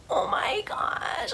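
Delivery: high, slow, soft mock wail; mock crying. Sister finding the dead child, mock crying. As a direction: imitate weeping in a thin high whimper. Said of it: "I'm, like, crying".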